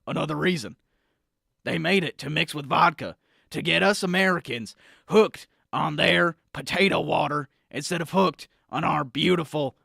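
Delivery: blustery talk-radio conspiracy crank; conspiracy pundit rant. Acted. Pundit badgering the host Sam about Gatorade, blustery rant. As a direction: rant like a paranoid supplement salesman.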